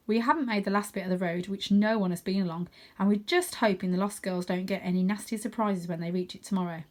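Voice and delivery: ordinary delivery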